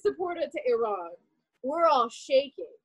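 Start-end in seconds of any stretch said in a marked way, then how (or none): none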